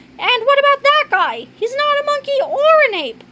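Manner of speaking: very high, indignant character voice; objecting character. Acted. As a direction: protest in a high-pitched voice. Figure objects to human.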